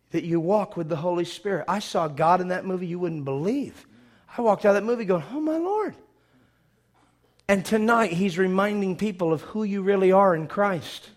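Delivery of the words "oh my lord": awed exclamation. Retold or imitated. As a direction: breathe out amazement in a raised voice. Recalling his awe leaving the cinema.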